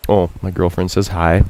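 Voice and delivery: normal talking voice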